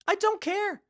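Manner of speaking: lightly voiced mock whine; mocking high voice. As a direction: toss off "I don't care" in a mock squeaky voice. Mocking high repeat of refrain.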